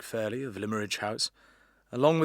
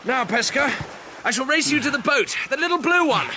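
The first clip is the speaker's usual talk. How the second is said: high, loud challenge across the water; calling out a race; 12 semitones higher, 6 dB louder than usual. Acted. Teacher challenging Pesca to race to the boat, loud high call. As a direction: shout the dare over the waves.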